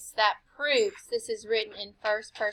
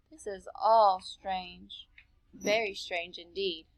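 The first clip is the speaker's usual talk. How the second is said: slow musing; 4 semitones lower, 8 dB quieter, slower than usual. Acted. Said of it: "I thought to myself".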